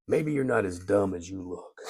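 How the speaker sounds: a modest stab at the champ's soft voice, quietly; a hint of Ali, toned down; bad Muhammad Ali imitation. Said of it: "I do a very bad Muhammad Ali imitation".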